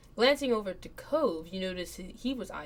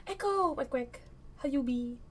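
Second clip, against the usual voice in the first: silly character voice; 7 semitones higher than usual. Acted.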